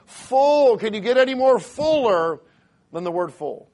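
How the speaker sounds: loud, slow, high-pitched preaching, hammering the word Full; preaching at full force. Acted. Preacher urging his church that full means full. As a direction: build from reading into a shouted climax.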